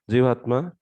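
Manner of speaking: usual speaking voice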